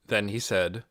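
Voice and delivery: ordinary delivery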